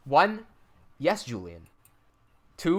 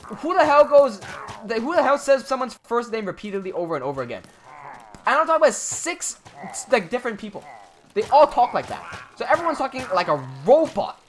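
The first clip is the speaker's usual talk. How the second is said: exasperated; 5 semitones higher, 5 dB louder than usual. Real, not acted. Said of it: "exasperated rant to chat".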